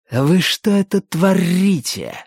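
put-on slow, high, accented angry bark. A narrator's reading. Third skinjacker scolds his two mates, barking. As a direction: bark it with heavy deliberate weight in a Russian accent. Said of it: "рявкнул он".

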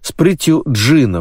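ordinary delivery

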